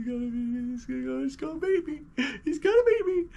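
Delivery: very high, squealing mock wail; panicked little-kid whimper, hammed-up; imitating terrified child. Kid hearing dad riding back, squeaky mock terror. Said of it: "I'm just like".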